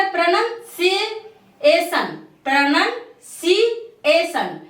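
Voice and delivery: प्रोनाउंसिएशन drawn out slowly, every syllable clear; slow model pronunciation. Shown as a demonstration. Teacher models the correct word for students, slow and clear. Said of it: "आज से आप क्या पढ़ेंगे?".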